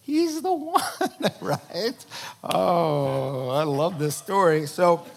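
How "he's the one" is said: high, slow, laughing accusation; accusing point. Retold or imitated. Queen accusing Haman at dinner, high and slow. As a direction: point the finger, drawn out and laughing. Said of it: "point her finger and say".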